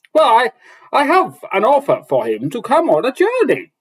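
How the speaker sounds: high, loud shout in the dwarf voice; dwarf shouts an offer up to Rand, high and loud. Acted. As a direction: shout it up the ladder, pitched high. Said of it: "the dwarf shouted back up".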